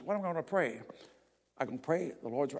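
usual speaking voice

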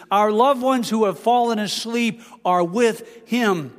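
ordinary talk, nothing marked

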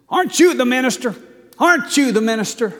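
high, loud, fast demanding retort; an irate neighbor taunting the preacher; neighbor's angry jab. Retold or imitated. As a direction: fling it at him twice, indignant. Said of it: "he says".

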